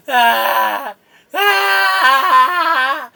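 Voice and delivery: loud, drawn-out scream of agony; pained scream. Acted. Guard takes nine damage to his kneecap, screaming. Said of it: "he's screaming and going".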